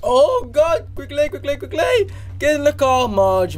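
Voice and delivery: high-pitched, frantic urging; panicked urging. Real, not acted. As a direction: hurry someone along in a high panic. Panicked urging during timed mission.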